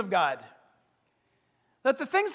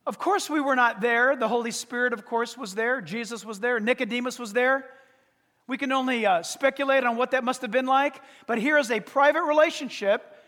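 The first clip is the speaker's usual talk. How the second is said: ordinary talk, nothing marked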